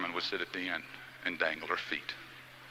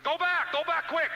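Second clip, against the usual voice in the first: high, loud imitation of frantic calling; urgent, high-pitched shout; urgent cry; 8 dB louder than usual. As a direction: yell it like someone running out in alarm. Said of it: "Charlotte came running out and said".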